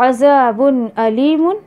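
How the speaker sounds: slow recited अज़ाबुन अलीमुन; measured Quranic recitation. Shown as a demonstration. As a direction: recite it slowly like tilawat. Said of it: "अल्लाह ताला बोल रहे हैं".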